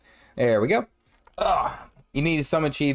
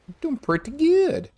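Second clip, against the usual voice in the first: much higher, squeaky and playful. Pretend. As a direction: squeak it out, way up high.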